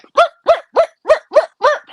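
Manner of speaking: high mock record skip; skipping record imitation. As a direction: mimic a stuck record repeating. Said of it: "they would be like".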